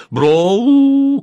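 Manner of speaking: very high, loud long howl; drawn-out cry from a sleeper; displeased shout. A narrator's reading. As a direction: yell in displeasure at what you understood. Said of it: "крикнул он".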